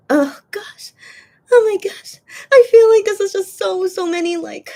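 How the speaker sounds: high, loud tearful outburst; real crying. Real, not acted. Streamer overwhelmed by a fan's words, sobbing loudly. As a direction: voice cracking as the tears come back. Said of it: "it's hard to talk when I'm crying".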